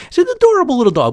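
ordinary delivery

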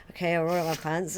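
acted agreeing reply, in a low-key voice; compliant house guest. Guest obeying the host's odd request, low-key compliance. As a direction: play the guest simply giving in. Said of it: "So you're like".

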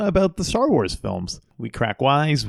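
ordinary talk, nothing marked

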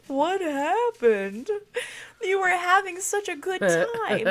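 high, loud, drawn-out retold dismay; mock-wail it, stretching the words out; imitating astonished partner. Husband walks in on her crying, mock astonished wail.

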